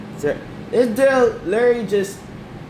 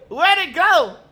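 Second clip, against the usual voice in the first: usual speaking voice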